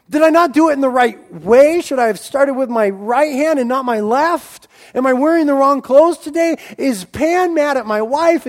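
worried pagan pleading with an angry god; anxious worshiper. Retold or imitated. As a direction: beg to know what went wrong. Worshipers anxiously question Pan.

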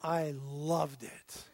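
slow, soft and low; tender. Real, not acted. Father remembering his sleeping son, slow and tender. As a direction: savour the memory quietly.

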